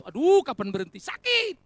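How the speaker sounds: loud, high mock wail of pain; hammed-up yell of agony; mock pained outcry. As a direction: cry out as if your ears hurt. Acting a listener begging singing to stop.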